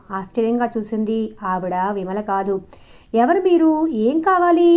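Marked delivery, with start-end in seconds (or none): 3.1-4.7 high, loud and sharp demand; sharp challenge. A narrator's reading. New teacher questioning strangers at the door, sharp and suspicious. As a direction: snap at the strangers in the doorway. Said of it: "పదునుగా ఉంది ఆవిడ కంఠం".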